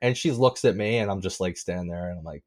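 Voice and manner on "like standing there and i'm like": usual speaking voice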